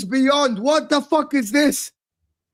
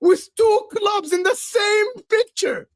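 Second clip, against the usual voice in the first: higher, stunned disbelief; 6 semitones higher than usual. Real, not acted. Chelsea fan seeing the transfer tweet, stunned disbelief. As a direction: react like you can't believe it.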